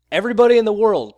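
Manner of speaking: loud, high, quick proclamation; mock welcoming call. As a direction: call out to all comers, mockingly. Mock welcome shouted to all comers.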